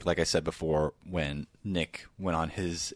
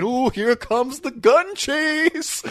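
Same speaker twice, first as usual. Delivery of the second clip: put-on much higher, slow baby-talk feeding voice; airplane spoon game; 6 dB louder, much slower than usual. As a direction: coax a toddler with the spoon. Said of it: "now I gotta make an airplane".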